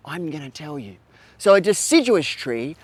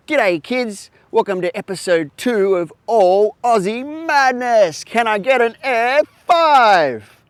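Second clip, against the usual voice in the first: bright, high, loud host greeting; kids show host; 5 semitones higher, 4 dB louder than usual. Acted. Kids show host greeting his young viewers, bright and loud. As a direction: beam at the kids like a TV presenter. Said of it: "let's try it again".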